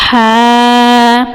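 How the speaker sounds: ہا breathed from the middle of the throat; tajweed letter demo. Shown as a demonstration. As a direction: push ہا from mid-throat. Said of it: "یہ وسط حلق سے حرف ادا ہوگا". (ہا وسط حلق سے)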